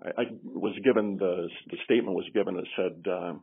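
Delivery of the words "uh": ordinary talk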